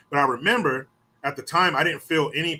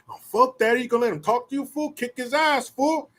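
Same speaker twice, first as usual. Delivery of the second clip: 高声起哄的语气; egging on; 10 semitones higher than usual. Retold or imitated. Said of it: "his homeboy start telling him".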